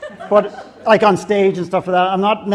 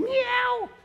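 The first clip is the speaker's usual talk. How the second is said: very high squeaky meow; squeaky fake meow with a laugh; mock cat meow; 8 dB quieter than usual. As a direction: meow like a cat to fool the guards. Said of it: "they go like this".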